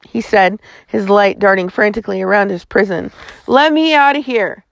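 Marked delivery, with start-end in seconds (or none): 3.5-4.6 higher-pitched, restrained roar; roared demand. A narrator's reading. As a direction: roar to be let out, without full volume. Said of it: "Slate roared".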